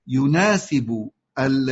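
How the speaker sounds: normal talking voice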